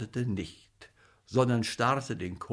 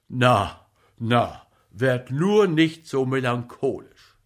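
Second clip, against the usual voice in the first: loud, animated comic actor urging a friend to recover; brisk bossy encouragement in a raised voice; rally the patient noisily; blustering comic actor; 4 semitones higher, 7 dB louder than usual. A narrator's reading.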